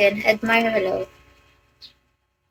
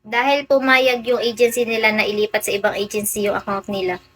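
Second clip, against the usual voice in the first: usual speaking voice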